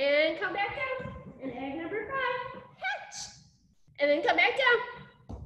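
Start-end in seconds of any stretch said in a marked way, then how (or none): 2.8-3.2 sudden high-pitched pop of a word; excited call-out. Pretend. Storyteller cueing children to burst from eggs, excited squeal. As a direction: burst out like a hatching egg.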